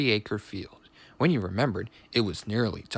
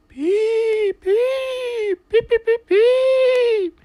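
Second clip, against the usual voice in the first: very high, loud wailing beeps; ghostly shriek; 9 dB louder, much slower than usual. A narrator's reading. Percy pretends to be a ghost in the shed, shrieking. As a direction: wail each beep like a howling phantom. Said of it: "he shrieked".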